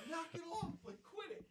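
very high, squeaky retold complaint; imitating a giggling protest; giggly protest. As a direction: tell them off with the giggles. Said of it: "I got the little giggles".

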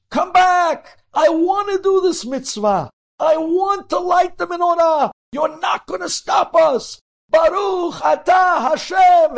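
very high, loud screams at the window; boy screaming. A narrator's reading. Boy screams through the frozen window at the soldiers. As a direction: scream after the men being dragged away. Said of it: "And he screams".

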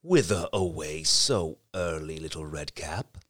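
low, slow, sly wolf. A narrator's reading. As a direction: drawl it like a cunning wolf. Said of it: "the wolf speaks".

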